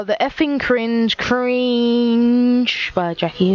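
stretched out slowly, mock announcer; mock grand announcement. Introducing his old cringe intro, slow and mock-grand. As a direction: drag each word out like a mock title card.

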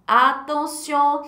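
ordinary talk, nothing marked

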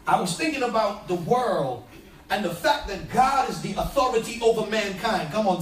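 usual speaking voice

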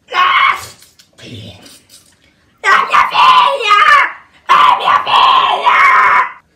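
high, anguished full-voice scream. Acted. Mother sees her daughter, anguished screaming. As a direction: scream it out, stretching the words. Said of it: "non urlare così".

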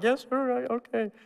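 put-on high, small scared-boy voice; meek little kid. Child answering his father, small and scared. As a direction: squeak out a timid yes sir.

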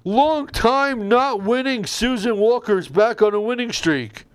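high, loud winner announcement; announcer voice. Acted. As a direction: call out the winner like a host.

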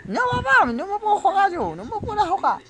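very high-pitched pleading; distressed protest. Real, not acted. Player telling papá he can't go on, distressed protest.

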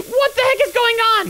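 high, startled outburst; alarmed exclamation. Acted. Dash seeing Rainy's train go wrong, alarmed. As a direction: pitch jumping up in alarm.

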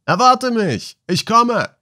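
very high, drawn-out answering call. A narrator's reading. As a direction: shout up the shaft to someone waiting above. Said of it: "tönte es wieder herauf". (hoch heraufgerufen)

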